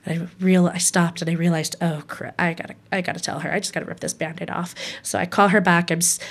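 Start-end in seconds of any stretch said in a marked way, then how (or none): 1.8-4.7 retold inner resolve, soft and hurried; hushed self-talk. Daughter realizes she must tell her mom, softly and quickly to herself.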